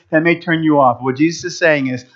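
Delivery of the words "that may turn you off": usual speaking voice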